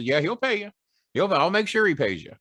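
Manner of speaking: lightly voiced Jimmy, pitched high; a hint of an eager manager vouching; Jimmy's voice. Jimmy backing up the bet, lightly voiced and high.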